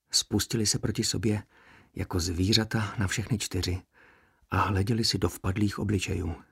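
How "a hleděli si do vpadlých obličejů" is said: plain reading voice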